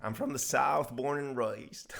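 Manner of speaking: put-on southern drawl; southern accent. Playing a born southerner for the co-host, mock southern accent. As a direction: ham up the southern vowels. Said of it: "you sound very southern".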